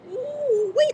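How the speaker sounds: delighted whoop. Real, not acted. Exclaiming delight.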